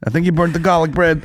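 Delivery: high, loud picky complaint; mock complaint. Dad complaining to his sons, mock picky grumble. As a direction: grumble about the garlic bread. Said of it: "Every day".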